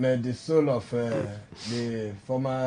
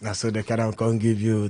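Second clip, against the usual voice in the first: ordinary delivery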